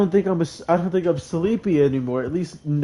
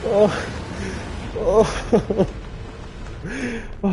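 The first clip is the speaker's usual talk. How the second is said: terrified screaming; 6 semitones higher than usual. Real, not acted. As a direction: scream in real terror. Screaming at sudden monster.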